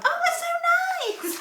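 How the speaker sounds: very high mock squeal; hammed-up delighted relative; gushing family squeal. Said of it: "That's what we're all like in my family".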